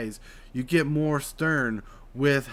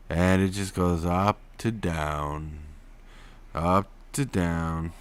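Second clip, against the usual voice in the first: mock drawl; 6 semitones lower, slower than usual. Shown as a demonstration. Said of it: "monotone drawl".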